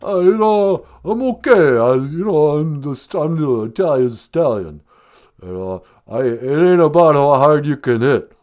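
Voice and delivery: movie boxer, halting and rambling; Sylvester Stallone. Acted. Film star giving the host his motto, halting boxer impression.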